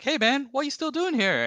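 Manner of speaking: very high, loud mock producer voice; a hammed-up boss checking in. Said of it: "And he's like".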